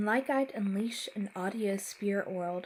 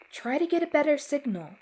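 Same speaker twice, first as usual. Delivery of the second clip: urgent; 7 semitones higher, 8 dB louder, faster than usual. Acted. Urgent instruction in panic.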